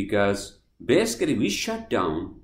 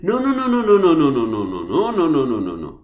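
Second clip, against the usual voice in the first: emphatic rapid denial; 5 dB louder, faster than usual. Real, not acted. Vlogger rejecting the shutdown excuse, emphatic denial. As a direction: shout the excuse down with repeated no's.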